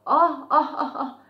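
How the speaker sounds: loud, high mock laugh; acted cackle of laughter. A narrator's reading. Ant laughing at the mouse nearly falling, acted guffaw. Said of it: "bir kahkaha sesi duydu".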